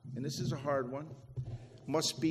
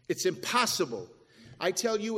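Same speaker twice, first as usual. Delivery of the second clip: ordinary talk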